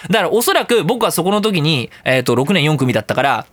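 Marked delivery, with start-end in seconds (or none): none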